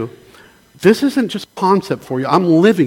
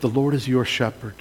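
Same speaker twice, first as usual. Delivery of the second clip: scripture reading; 3 dB louder than usual. Acted. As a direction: read it like a comforting promise, swelling louder mid-psalm. Reads Psalm twenty-three aloud.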